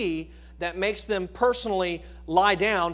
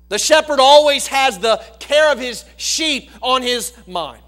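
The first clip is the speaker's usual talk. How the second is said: louder and higher, fiery pulpit preaching; full-force preaching; 4 semitones higher, 9 dB louder than usual. Acted. Preacher rousing the church with David's psalm, full-force preaching. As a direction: preach it out to the whole congregation.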